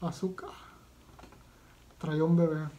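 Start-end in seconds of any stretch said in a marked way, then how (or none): 0.0-0.5 whispered imitation of a cry; soft, stunned echo under the breath; hushed echo. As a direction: repeat the heard scream as a whisper. Echoes the clip's scream softly.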